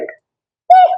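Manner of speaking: high, lilting la la la, unbothered; carefree sing-song. Retold or imitated. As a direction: playfully hum through the chaos. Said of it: "so much that you're like".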